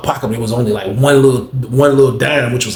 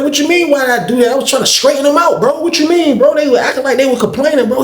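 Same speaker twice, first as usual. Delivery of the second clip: much higher, pushing back hard; indignant retort; 14 semitones higher than usual. Retold or imitated. Snapping back at Johnny over the fifties, high and indignant. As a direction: defend yourself, outraged.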